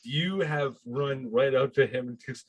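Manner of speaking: normal talking voice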